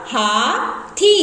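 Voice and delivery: teacher stretching हाथी slow; slowly drawn out. Shown as a demonstration. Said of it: "तो बन जाएगा".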